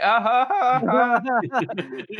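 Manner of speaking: high, loud, drawn-out fake laugh; exaggerated awkward laughter, pitched high; mock nervous laugh. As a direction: imitate a forced nervous ha-ha. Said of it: "he gave a nervous laugh".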